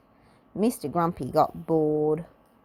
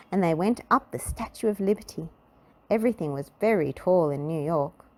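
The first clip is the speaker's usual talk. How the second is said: plain reading voice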